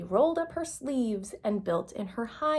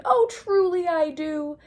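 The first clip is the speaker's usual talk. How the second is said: big booming uncle's delight; hooting uncle; 8 semitones higher, 6 dB louder than usual. Acted. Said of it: "Fred hooted".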